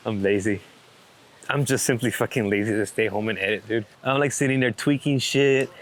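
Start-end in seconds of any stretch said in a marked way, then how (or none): none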